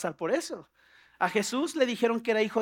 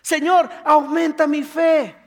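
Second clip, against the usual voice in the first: loud, slow, high petition; acted impassioned plea; fervent prayer; 5 semitones higher, 9 dB louder, slower than usual. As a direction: cry out boldly to God.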